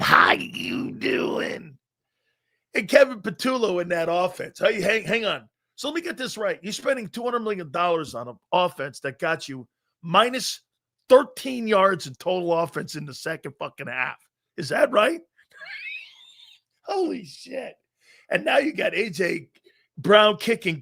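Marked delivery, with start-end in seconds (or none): 0.0-1.8 low, drawn-out "How you doing?" in a put-on voice; hammed-up low slow pickup-line greeting; mock deep slow greeting. 16.0-17.7 "Holy shit" squeezed out through a laugh; real laughing holy shit; laughing disbelief. Real, not acted. Laughing at offense stats.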